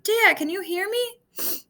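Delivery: high, slow pleading call; desperate call. Acted. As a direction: call out slowly, searching for him. Calling lost phone after crash.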